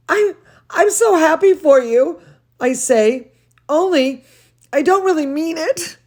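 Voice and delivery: lively read-aloud voice; storybook reading for children; story reading. A narrator's reading. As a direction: read to the kids with expression. Reads picture book aloud.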